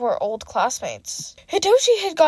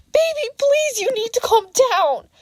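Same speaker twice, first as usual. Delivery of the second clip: frantic yell; 11 semitones higher than usual. A narrator's reading. Parent yelling for a child among fireworks, high and frantic. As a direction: cry out to a panicking child. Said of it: "Someone yelled".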